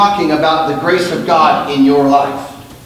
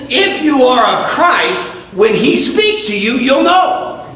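high, slow, ringing preacher voice; fiery preaching; 11 semitones higher, slower than usual. Real, not acted. Pastor urging the church to know God's voice, fiery preaching. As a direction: preach it to the back row.

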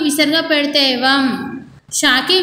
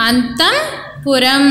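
very slow "Antahpuram", split into "antam" and "puram"; slow broken-up demonstration; much slower than usual. Shown as a demonstration. Teacher breaks the word up for children, very slow "Antahpuram". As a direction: stretch "Antahpuram" out in two parts with a gap between. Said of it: "మరి వాటిని ఎలా పలకాలి".

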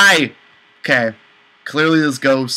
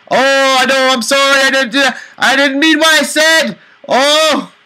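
panicked apology; 9 semitones higher, 4 dB louder than usual. Real, not acted. Ghost reacts badly to flirting.

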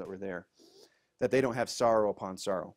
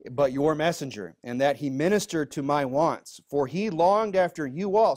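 raised, louder Bible-reading voice; scripture reading; 3 semitones higher, 6 dB louder than usual. Acted. Pastor reading scripture to the congregation, raised voice. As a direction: read the verse out with weight to the room.

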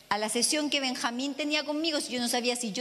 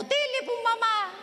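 teasing daughter; 8 semitones higher, slower than usual. Retold or imitated.

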